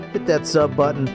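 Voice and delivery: ordinary delivery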